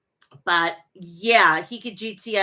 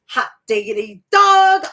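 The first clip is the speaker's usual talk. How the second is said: mock delighted exclaim; 3 semitones higher, 9 dB louder than usual. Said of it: "I was like".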